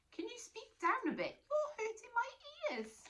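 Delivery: very high pleading squeak; tiny monster. Acted. Monster begging quieter.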